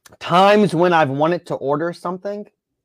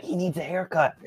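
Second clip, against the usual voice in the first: hushed aside, much softer; leaned-over whisper; 2 semitones higher, 9 dB quieter than usual. Retold or imitated. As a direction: say it under your breath. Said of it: "leaned over and just said".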